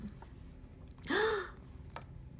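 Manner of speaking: high breathy gasp; surprised gasp. Acted. Reading to a child, a happy surprised gasp. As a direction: gasp in delight. Kitten reappears in story.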